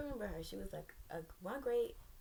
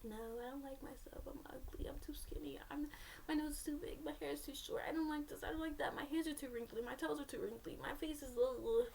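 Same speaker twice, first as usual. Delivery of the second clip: insecure younger self; 7 semitones higher than usual. Retold or imitated. Her past self lists what she dislikes, in a high insecure voice. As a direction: imitate your old self picking at every flaw. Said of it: "But me then was".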